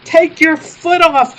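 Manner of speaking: loud, high, slow holler. Acted. Donnie hollering at the driver, loud and slow. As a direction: holler the order across the yard. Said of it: "hollered Donnie".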